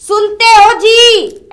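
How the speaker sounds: "सुनते हो जी" बहुत धीमे, खींचकर; पति को आदर से पुकारने का नमूना; दिखाकर बोला गया पुकारना. Shown as a demonstration. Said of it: "ऐसे बोल दो।". (जेठानी देवरानी को सिखाती है, धीमा आदर भरा "सुनते हो जी")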